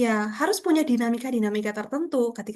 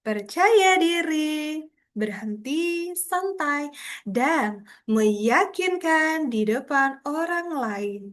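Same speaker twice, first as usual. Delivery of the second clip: "Percaya diri" stretched slow and emphatic, then a pause before "dan meyakinkan di depan orang lain"; pauses and stress; 4 semitones higher, much slower than usual. Shown as a demonstration. Teacher models pausing and emphasis for her online class, very slow. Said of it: "Berhenti dulu".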